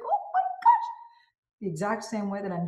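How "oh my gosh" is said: very high delighted squeal; squealed oh my gosh. Shown as a demonstration. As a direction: shriek it with joy. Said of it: "It's like".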